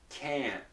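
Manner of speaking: "can't" with the tongue stopping the sound; unreleased T. Shown as a demonstration. Teacher explaining the mechanism, "can't" with held T. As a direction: end "can't" abruptly, no puff. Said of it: "the T is not released".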